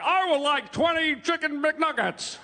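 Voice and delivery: high, slow caricature of an American; mock American accent. German comedian imitates Americans ordering, mock American accent. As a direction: exaggerate a bad American accent, drawn out. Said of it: "and then Americans were like".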